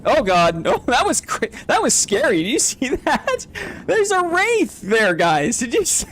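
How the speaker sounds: jolted by a wraith, voice leaping high; real scare. Real, not acted. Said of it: "I got scared".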